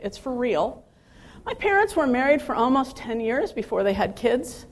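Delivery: normal talking voice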